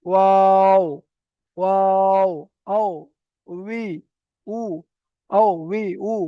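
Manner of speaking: ওয়াও loud and rounded, lips not closing; Arabic waw drill, loud and open; waw sound drill. Shown as a demonstration. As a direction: call out ওয়াও, আও, উই, উ, lips apart. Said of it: "ওয়াওটা উচ্চারণ হবে".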